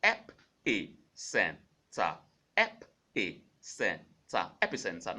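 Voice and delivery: epicenter said slowly and clearly; slow model word. Shown as a demonstration. Teacher drilling epicenter slowly. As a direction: stretch out epicenter. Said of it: "It is epicenter".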